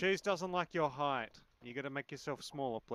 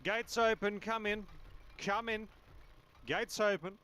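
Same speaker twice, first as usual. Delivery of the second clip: calling out; 6 semitones higher than usual. Acted. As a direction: announce the open Australian gate like a herald. Calling visitors through the gate.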